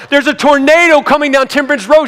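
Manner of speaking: acted urgent near-shout; frantic urging. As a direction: argue in panicked exasperation. Acted quarrel, urging wife to go.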